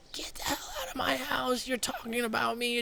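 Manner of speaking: shrill-pitched imitation of her hysterical yelling; imitating a screaming girl. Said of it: "screaming at me, hyperventilating".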